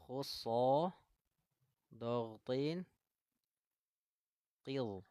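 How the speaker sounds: heavy letters recited slowly with pauses between words; tajwid recitation. Shown as a demonstration. Teacher shows students the mnemonic for the heavy letters, recited. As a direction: recite "Khusso, dogtin, qil" as a tajwid drill, each word set apart. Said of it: "Nih, contoh nih".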